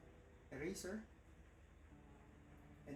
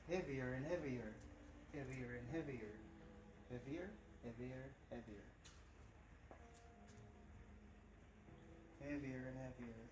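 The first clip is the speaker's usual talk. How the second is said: softer with each repeat; fading hypnotic repetition; 4 semitones lower, 6 dB quieter than usual. Acted. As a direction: sink the voice as it repeats.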